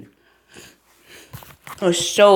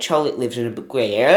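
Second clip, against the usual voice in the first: ordinary talk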